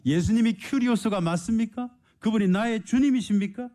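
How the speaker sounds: high, ringing preacher's challenge; fervent preaching. Acted. Preacher challenging the congregation, ringing high cry. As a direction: call it out to the whole church.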